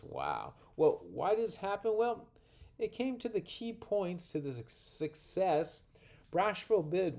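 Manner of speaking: usual speaking voice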